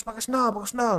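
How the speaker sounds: high, scolding mother voice; nagging mom. Retold or imitated. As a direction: nag like a mom about sandals. (suara emak-emak mengomel, tinggi)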